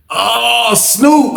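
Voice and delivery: high, rising cry of realization; sudden recognition. Retold or imitated. Inmate guessing who the tattoo resembles, amazed recognition. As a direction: call out the answer as it hits you.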